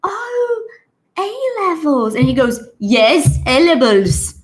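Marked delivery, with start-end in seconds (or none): 0.0-2.1 very high, dawning "oh", staying high; British lady's realisation. Retold or imitated. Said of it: "She goes". 2.8-4.4 loud, exasperated mimicry of the learner; imitated angry insistence. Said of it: "he's very angry at her".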